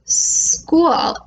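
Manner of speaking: "School" said with a long hissed "S" at the start; "School" with the initial S drawn out, then a falling tune; stretched S sound. Shown as a demonstration. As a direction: hold the "S" in "School" before the "k". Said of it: "It starts with S".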